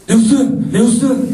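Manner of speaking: high-pitched retold call; acted call, voice lifted high. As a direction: call someone's name to get his attention. Calling out to the man.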